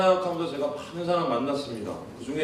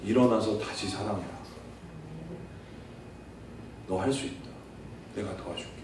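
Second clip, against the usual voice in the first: low, soft and tender, fading almost to a hush; gentle voice of Jesus; 7 semitones lower, 7 dB quieter than usual. Acted. Jesus lifting up the fallen believer, tender low voice. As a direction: speak lower and softer with each line, like comforting a fallen friend. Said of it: "이렇게 말씀하십니다".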